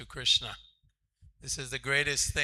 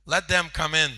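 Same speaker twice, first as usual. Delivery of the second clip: loud call to let them in; 4 semitones higher, 10 dB louder than usual. Retold or imitated. Prabhupada telling the guards to admit the boys, loud.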